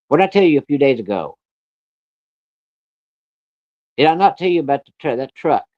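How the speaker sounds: retold angry dad demanding an answer; acted scolding. Acted angry father scolding child.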